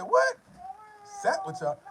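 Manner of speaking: high shocked what. Retold or imitated. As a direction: cry out in disbelief. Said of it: "I said".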